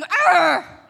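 loud mock lion roar; a big hammed-up growling roar. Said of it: "they were probably going".

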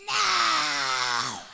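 long, harsh mock-child yell; drawn-out tantrum roar; shouted refusal. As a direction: imitate a furious kid bellowing up the stairs. Son refuses from downstairs.

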